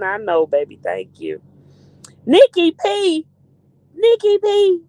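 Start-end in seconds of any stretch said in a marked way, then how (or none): 2.3-4.8 high, loud chant of the name; sing-out shout of the name; calling out. Real, not acted. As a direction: announce an arrival with delight. Calls out arriving viewer.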